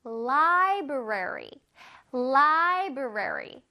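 library joined back up, said very slowly; slow blended drill. Shown as a demonstration. Teacher blending the syllables for children. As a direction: put lie and brary together, slowly. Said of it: "합쳐서".

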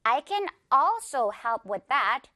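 slow model sentence with a long AL in also; stress on ALSO. Shown as a demonstration. Teacher models the example sentence, slow with front-stressed also. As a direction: say it very slowly, leaning on the first syllable of also. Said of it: "어 부분은 길게".

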